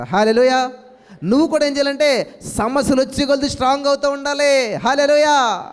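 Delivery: full-force preaching. Acted. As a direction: preach it out to the church with rising fervour.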